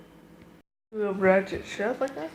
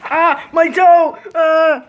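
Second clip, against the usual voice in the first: real yelp, much higher and louder, slow; pained yelp; 9 semitones higher, 9 dB louder, much slower than usual. Real, not acted. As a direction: cry out from a stubbed toe. Stubs toe.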